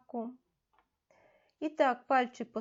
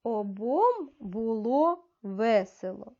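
slow, a little louder, carefully read; slow model reading; 5 dB louder, slower than usual. Shown as a demonstration. Teacher reads for children to repeat, slow and clear. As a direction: read it slowly so they can repeat. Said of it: "Повторюйте".

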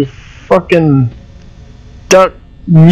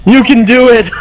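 self pep talk; 5 semitones higher than usual. Real, not acted. Streamer nervously cheers himself on during the game. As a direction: cheer yourself on through the fear.

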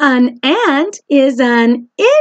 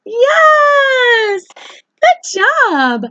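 cheering; 11 semitones higher, much slower than usual. Real, not acted. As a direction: celebrate the answer. Cheering a child's answer.

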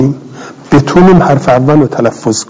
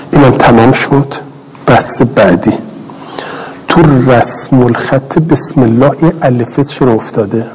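ordinary delivery

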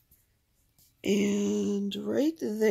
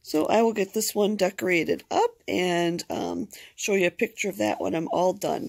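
ordinary delivery

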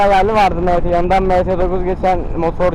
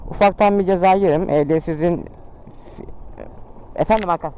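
normal talking voice

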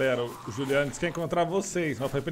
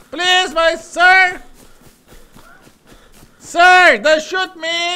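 loud, high desperate begging; pleading in English; 14 semitones higher, 12 dB louder, slower than usual. Pretend. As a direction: beg for your life, yelling. Begging enemy player in English. (súplica desesperada gritando)

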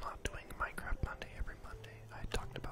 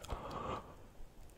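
long whispery gasp; surprised gasp; 4 dB quieter than usual. Real, not acted. Player spots a cat mid-story, gasping. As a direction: gasp at a sudden find.

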